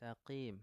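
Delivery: "Taqim" recited softly to show the qaf with kasra; tajwid demo. Shown as a demonstration. Teacher repeats yesterday's example for students, softly recited. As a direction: model "Taqim" with a reduced heavy qaf. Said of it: "Coba, mustaqim".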